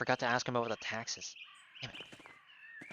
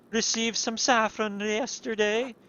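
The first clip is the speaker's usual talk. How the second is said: high, slow gossiping voice; monk voice; 7 semitones higher, 3 dB louder than usual. Acted. Monk passing on gossip, high and slow. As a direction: lift the voice high and take it slow. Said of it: "these fucking wild accents".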